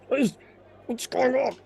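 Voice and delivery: high, strained mock bewilderment; bewildered villain. Merchant stuck under a holding spell, strained bewilderment. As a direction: act out being suddenly frozen.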